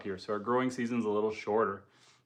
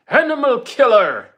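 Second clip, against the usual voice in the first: much higher, louder, hammed-up accusation; mock outraged yell; mock accusing shout; 12 semitones higher, 13 dB louder than usual. Reading troll's accusation.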